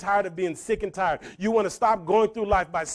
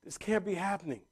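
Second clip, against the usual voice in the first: softly voiced, stunned mom; stunned disbelief; 2 semitones lower, 10 dB quieter than usual. Mom hears the bank can foreclose, quiet disbelief. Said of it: "my mom's like".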